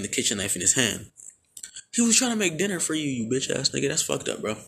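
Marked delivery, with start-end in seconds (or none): 1.9-4.6 high, indignant scolding aside; mock outrage. Real, not acted. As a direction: call him out in disbelief.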